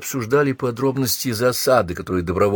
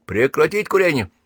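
higher, irritated command; mild angry order; 7 semitones higher than usual. A narrator's reading. Sergeant venting anger on a stormtrooper who lit up, mildly angry order. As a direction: snap the order with restrained anger. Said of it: "срывая злость, прикрикнул".

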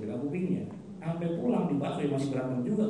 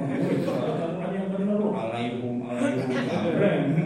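hammed-up yell at the imam; louder, half-joking reprimand; mock scolding; 2 semitones higher, 5 dB louder than usual. Ignorant worshipper correcting the imam, mock angry shout.

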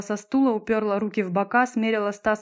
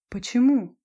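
higher, louder startled question; surprised; 4 semitones higher, 5 dB louder than usual. A narrator's reading. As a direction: react to unexpected bad news, taken aback. Olga surprised by bad news.

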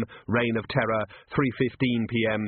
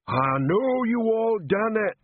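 slow, much higher bellowing troll; troll shouting; 11 semitones higher, 3 dB louder, slower than usual. Acted. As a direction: shout it at the lined-up staff. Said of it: "he was shouting".

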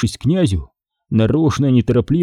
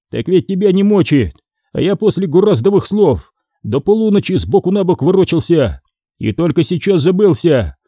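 higher-pitched grumpy complaint, lightly acted; displeased; 6 semitones higher than usual. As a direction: complain at being woken from sleep. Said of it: "сказал недовольно". (недовольно, повышенным голосом)

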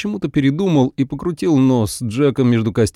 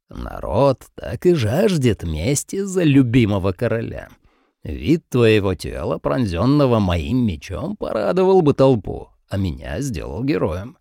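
cold king voice. Acted.

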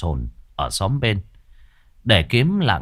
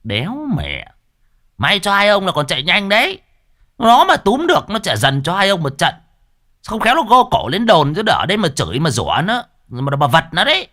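high, loud exasperated rebuke; exasperated scolding; 11 semitones higher, 5 dB louder than usual. Acted. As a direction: scold them after a weary sigh. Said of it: "Cậu Cẩn thở dài".

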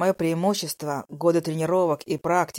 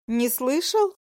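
mocking; 8 semitones higher, slower than usual. Dragoness mocking his words.

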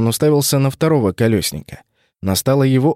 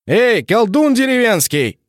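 high, slow yelled taunt; shout; 11 semitones higher, slower than usual. Acted. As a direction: yell it across the field. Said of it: "заорал я".

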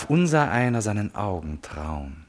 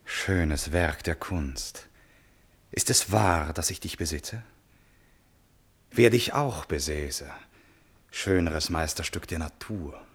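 tender reverie; 5 semitones lower, slower than usual. Acted. Prince alone with the portrait.

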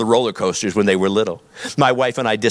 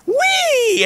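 very high, long imitated child's whee; gleeful squeal. Daughter as the coaster comes down, mock gleeful squeal. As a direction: squeal it way up high, like a thrilled kid. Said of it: "you'd hear the word".

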